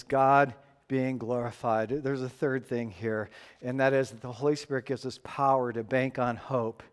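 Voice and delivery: ordinary talk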